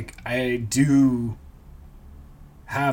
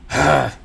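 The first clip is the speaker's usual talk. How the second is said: annoyed sigh; 6 dB louder than usual. Retold or imitated. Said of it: "the annoyed sigh would be like a".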